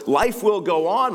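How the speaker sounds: emphatic refrain. Real, not acted. Refrain, louder and higher.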